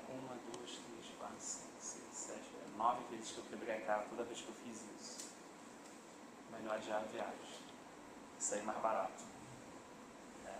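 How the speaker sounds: very soft, low muttered counting; hushed inner reckoning. Acted. Man tallying his past failures to himself, hushed and low. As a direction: think it through under your breath, low.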